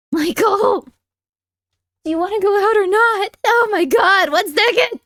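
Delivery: loud high calling to the cat; exasperated yelling. Real, not acted. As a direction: shout it out fed up.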